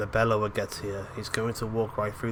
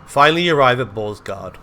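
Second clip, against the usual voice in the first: usual speaking voice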